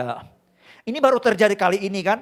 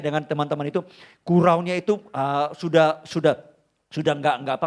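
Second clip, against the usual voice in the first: normal talking voice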